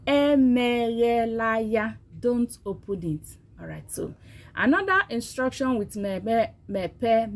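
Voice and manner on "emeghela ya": emeghela ya said slowly and clearly, falling; careful classroom Emeghela ya; slow teaching demo. Shown as a demonstration. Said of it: "Say it with me".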